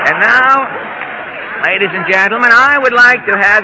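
big stretched showman call; announcer voice. Real, not acted. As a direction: boom it out like a radio host.

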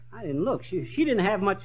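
normal talking voice

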